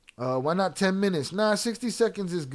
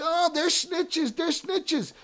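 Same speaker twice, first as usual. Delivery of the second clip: mock whiny outrage; 10 semitones higher, much slower than usual. Streamer mimics outraged chatters, mock whiny. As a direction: mimic their whining, slowly.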